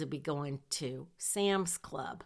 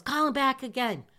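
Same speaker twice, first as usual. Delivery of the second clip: higher and louder, pressing hard; panicked friend in the car urging her to call back, raised voice; urgent demand; 7 semitones higher, 5 dB louder than usual. Retold or imitated. Panicking friend urges again.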